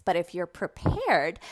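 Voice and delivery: ordinary talk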